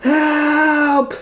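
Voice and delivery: very high shriek. Acted. Rosie shrieking as the cat grabs her. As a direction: let out a thin high shriek. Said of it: "shrieked Rosie Roden".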